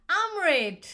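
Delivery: Amrit as a loud, drawn-out "AAM-ret"; Ugandan pronunciation. Shown as a demonstration. Giving viewers a third version of the name, Ugandan pronunciation. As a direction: punch out Amrit slowly, open "ah", "ret" ending. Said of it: "In Uganda, where I'm from, it's called Amrit".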